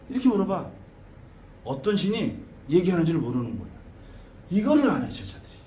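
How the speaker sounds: ordinary talk, nothing marked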